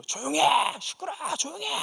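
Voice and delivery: father's retold shout. Father yelling at the women to hush, mock shout. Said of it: "그분들한테 막 소리를 지르는 거예요".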